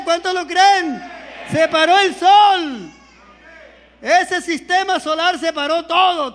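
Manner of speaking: loud, slow, pounding preacher's declarations; full-force preaching. Acted. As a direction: hammer each line home to the congregation.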